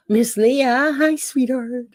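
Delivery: happy surprise at an arrival; delighted greeting. Real, not acted. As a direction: gasp and greet a dear friend.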